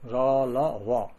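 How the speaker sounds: ラ, ラ, ワ very slowly; slow syllable demo. Shown as a demonstration. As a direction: draw out ラ、ラ、ワ one by one. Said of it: "ワありますね".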